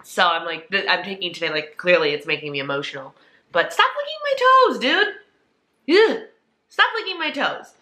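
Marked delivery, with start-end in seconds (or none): 3.5-7.7 much higher, louder squeal at the pet; pet-scolding voice. Real, not acted. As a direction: squeak at the dog licking your toes. Telling pet off mid-vlog.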